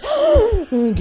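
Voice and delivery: high, sharp acted gasp; frozen-in-fear gasp. Tika freezes at the figure overhead, high gasp. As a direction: suck in a frightened breath. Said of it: "Aku Nadia nge-freeze".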